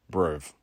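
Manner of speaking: quiet "Brave" said "breev"; "brave" as "brev". Shown as a demonstration. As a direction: say "Brave" like it is spelt B R E V. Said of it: "B R E V".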